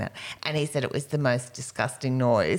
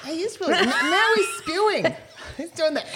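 talking to a dog; 4 dB louder than usual. Real, not acted.

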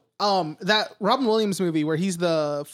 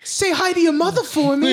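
put-on Boston accent; 8 semitones higher, 4 dB louder than usual. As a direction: Mark Wahlberg Boston accent impression. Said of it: "Dude, do his accent".

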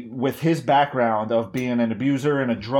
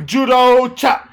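slow, high, loud judo chop call; mock battle cry; 8 semitones higher, 7 dB louder, much slower than usual. Friend egging on mock fight, slow loud battle cry. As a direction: bellow it like a cartoon fighter.

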